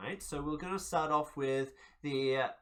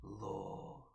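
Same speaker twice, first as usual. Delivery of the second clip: reptilian god voice; 6 semitones lower, 14 dB quieter, slower than usual. Acted. GM voices god in player's mind.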